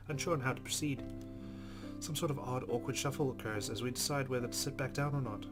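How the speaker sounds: usual speaking voice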